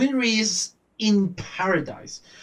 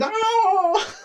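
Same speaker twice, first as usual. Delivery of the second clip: mock scream; 13 semitones higher than usual. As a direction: imitate a cartoon scream of "oh". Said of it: "Winry just screaming".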